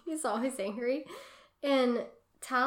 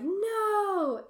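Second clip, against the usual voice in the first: high, loud, drawn-out mock yell; mock cry of protest; 11 semitones higher, 6 dB louder than usual. Retelling Doctor Thorne being defeated, a mock cry. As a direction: stretch it into a rising mock scream.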